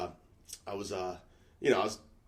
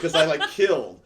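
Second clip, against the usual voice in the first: bursting out laughing; loud laugh into the words; 5 semitones higher, 7 dB louder than usual. Real, not acted. Laughing at own story.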